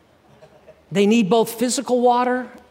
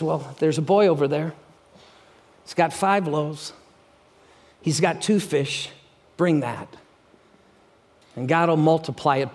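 calm Jesus; 5 semitones lower than usual. Acted. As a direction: settle the panic gently. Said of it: "he just goes like this".

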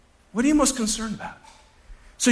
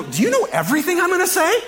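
exasperated retort; 10 semitones higher, 4 dB louder than usual. Retold or imitated. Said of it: "I just wanna go".

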